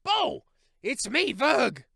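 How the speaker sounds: high, loud cry of recognition; shout. A narrator's reading. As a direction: call out his own name from the sand. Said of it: "push his head up and shout".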